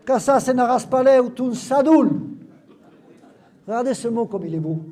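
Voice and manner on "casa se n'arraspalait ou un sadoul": Occitan line read out, louder; lecture en occitan. Acted. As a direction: read the patois sentence clearly. Lit un texte occitan. (lecture appuyée en occitan)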